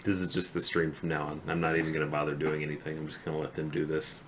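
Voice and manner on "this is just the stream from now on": ordinary talk, nothing marked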